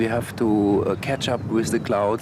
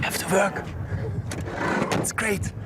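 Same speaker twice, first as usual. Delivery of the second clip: high, thrilled burst of joy; excited; 9 semitones higher than usual. Real, not acted. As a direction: pitch up with real excitement. Photographer rushing to a tornado.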